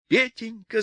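put-on high-pitched cry to a baby; wailing cry. A narrator's reading. Mother cries to the bundle, high wailing. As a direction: wail the pet name. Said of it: "закричал Людмила свёртку".

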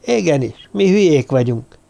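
usual speaking voice

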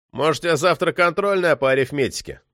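ordinary delivery

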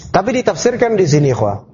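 ordinary delivery

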